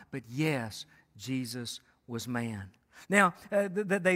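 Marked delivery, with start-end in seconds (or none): 0.1-2.7 lower, softer and slow, landing the point; soft emphatic close. Real, not acted. Preacher tells his church Jesus was fully man, soft and slow. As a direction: drop the voice and let it land.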